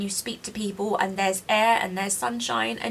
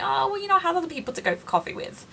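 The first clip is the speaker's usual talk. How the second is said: higher, quick, mock-polite brush-off; dismissive friend; 8 semitones higher, faster than usual. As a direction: imitate a friend turning you down sweetly. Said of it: "And they're like".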